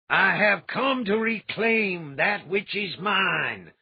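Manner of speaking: loud, slow booming declaration; a mad fire lord claiming his due; voice of thunder. A narrator's reading. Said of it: "Gend declared in a voice of thunder".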